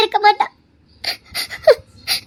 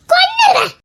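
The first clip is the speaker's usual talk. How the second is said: high shouted threat to kill; furious threat; 7 semitones higher than usual. Acted. Mother threatening to kill the woman. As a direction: threaten her at full force.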